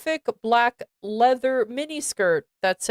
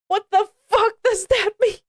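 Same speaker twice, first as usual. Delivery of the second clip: much higher, fast, laughing through the question; high laughing disbelief; laughing incredulous; 9 semitones higher, 3 dB louder, faster than usual. Real, not acted. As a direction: crack up in disbelief as you ask it. Reader breaks up at fanfic line.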